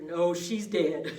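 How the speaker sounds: loud, drawn-out blurt; imitated sudden realisation. Resident working out mama's age, imitated loud realisation. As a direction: land on it loudly and slowly, as if just worked out.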